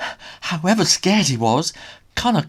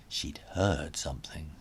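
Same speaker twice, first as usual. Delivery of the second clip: whisper; 10 semitones lower, 11 dB quieter than usual. A narrator's reading. Hushed suspense narration.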